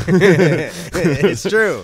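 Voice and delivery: laughing through the words. Real, not acted. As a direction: say it mid-laugh, amused. Guest laughing at joke.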